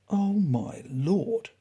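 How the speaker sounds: slow, drawn-out disbelief; incredulous. Acted. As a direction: stretch it out as if you can't believe it. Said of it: "the newcomer said incredulously".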